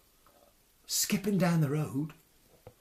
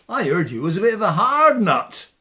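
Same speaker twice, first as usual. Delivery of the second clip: swaggering loud taunt; cocky taunt; 3 semitones higher, 13 dB louder, faster than usual. Acted. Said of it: "says Tom".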